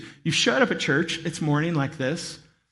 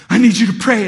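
urgent plea; 4 semitones higher, 10 dB louder than usual. Retold or imitated. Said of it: "he was like".

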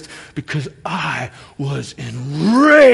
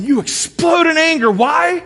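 high, raised shout on the point; explode in anger; 10 semitones higher, 4 dB louder than usual. Acted. Pastor driving home the anger point, raised high shout. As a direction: hit it with a preacher's shout.

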